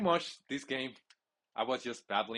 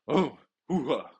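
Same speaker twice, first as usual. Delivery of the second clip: Al Pacino hoo-ha; 3 semitones higher, 6 dB louder than usual. Retold or imitated. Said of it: "I didn't imitate Al Pacino voice".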